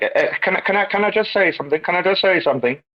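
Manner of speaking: high, quick, stammered request; English guy butting in. Retold or imitated. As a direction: imitate someone pleading to get a word in. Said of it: "English guy".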